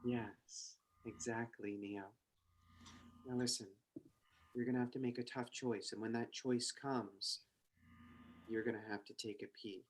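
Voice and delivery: low hushed mysterious woman. Acted. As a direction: speak low and secretive, like a mysterious agent. Trinity voice continues.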